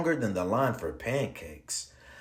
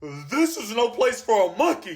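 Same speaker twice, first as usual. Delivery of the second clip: raised, higher-pitched indignant yell; narrated yell; 6 semitones higher than usual. A narrator's reading. Shocked man yells at the monkey, mild acted yell. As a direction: scold in a storybook yell, not full volume. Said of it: "he yelled".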